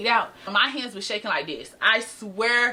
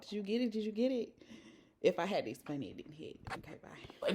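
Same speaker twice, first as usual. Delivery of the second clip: much softer, half to herself; hushed aside; 3 semitones lower, 14 dB quieter than usual. Real, not acted. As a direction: drop the voice for a sly aside. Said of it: "aside explaining her own joke".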